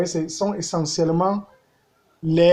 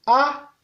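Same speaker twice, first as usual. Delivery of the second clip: A held on a high, level tone; 8 semitones higher than usual. Shown as a demonstration. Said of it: "Ton haut". (« A » au ton haut)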